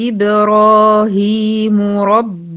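slow, drawn-out melodic tajweed chant; Quran recitation. Acted. Teacher reciting the verse to her students, slow melodic chant. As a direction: chant each verse slowly with long held vowels.

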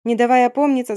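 usual speaking voice

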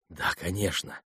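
usual speaking voice

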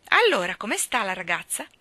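loud, irreverent greeting; cheeky. A narrator's reading. Starling greeting Mary Poppins, irreverent and cheeky. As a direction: toss it out with playful disrespect. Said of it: "chiese con irriverenza".

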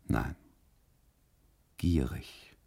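normal talking voice

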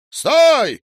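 loud, high shout; calling out. A narrator's reading. Man hailing a driver in the street, shouting. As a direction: holler to halt a car. Man hails a passing car.

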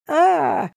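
high terrified scream; high-pitched terrified wail. A narrator's reading. Man seeing the vampire, high shriek. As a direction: let out a high shriek of fear. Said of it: "на высокой ноте завопил Кирилл".

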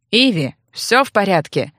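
muffled through a closed door. Acted. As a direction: speak as if behind a door. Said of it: "глухо спросил Февр".

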